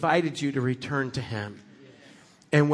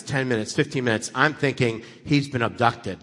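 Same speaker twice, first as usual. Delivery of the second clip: normal talking voice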